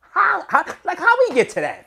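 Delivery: high, loud, fast incredulous squeal; can't believe what he's hearing; rising outrage, voice cracking up high; exasperated disbelief. Real, not acted. Exasperated at blogger's claim.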